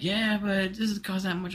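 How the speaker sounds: mocking drawn-out complaint as the wife; imitated wife. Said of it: "she's like".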